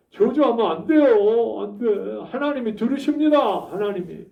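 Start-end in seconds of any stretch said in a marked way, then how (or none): none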